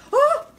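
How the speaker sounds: loud high "oh"; sudden shriek of alarm; startled yelp. Real, not acted. Woman as mouse moves, startled yelp.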